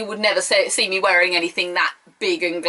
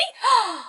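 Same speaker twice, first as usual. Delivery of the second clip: sharp gasp; 13 semitones higher than usual. Real, not acted. Another shock announcement.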